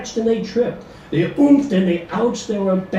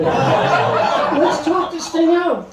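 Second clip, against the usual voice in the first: pleading husband; 11 semitones higher, 3 dB louder, much slower than usual. A narrator's reading. Husband pleads mid-fight.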